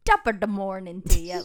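loud, put-on Irish accent. Shown as a demonstration. Co-host asked for one sentence, hammed-up Irish accent. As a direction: belt out a stock Irish greeting accent. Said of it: "I wanna hear your Irish".

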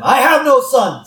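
high, loud outburst as a rabbi; angry yell. Retold or imitated. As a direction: yell it in sudden outrage, acting out the rabbi. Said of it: "He just yells at him".

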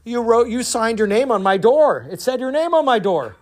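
high, insistent comeback; exasperated retort. Acted. Man pointing out the name on his door, exasperated. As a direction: push the obvious point, pitched high.

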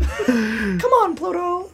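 high squeaky call to the dog; Mickey Mouse. Pretend. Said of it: "Mickey Mouse impression".

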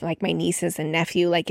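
ordinary talk, nothing marked

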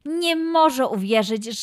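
ordinary delivery, nothing marked

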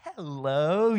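loud, drawn-out put-on hello; switched phone voice. Woman mid-argument answering the pastor's phone call, voice suddenly changed. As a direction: instantly shift into an exaggerated phone greeting. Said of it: "The whole voice changes".

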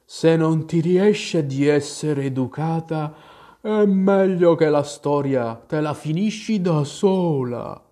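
drawn-out testy creature reproach; annoyed. A narrator's reading. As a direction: scold wearily, dragging the words. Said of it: "le fece notare con tono seccato".

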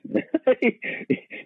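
breathy burst of laughter, very high; amused unvoiced laugh; airy high laugh. Real, not acted. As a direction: let a silent laugh escape. Laughs at God moving fast.

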